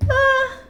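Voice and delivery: a sharp high loud Ah of surprise; sudden high cry. Real, not acted. Reader startled by a card, yelps out loud.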